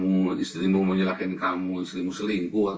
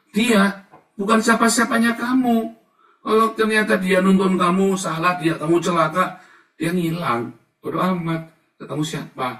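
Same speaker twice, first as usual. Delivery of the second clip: loud, much higher, fiery sermon delivery; preaching at full force; 8 semitones higher, 8 dB louder than usual. Acted. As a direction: warn the flock with fire. (khotbah lantang penuh semangat)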